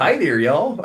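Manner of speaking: high, loud Southern greeting; Southern accent. Shown as a demonstration. Showing the accent that pops out, exaggerated Southern. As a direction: greet them in a playful Southern twang. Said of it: "that comes out".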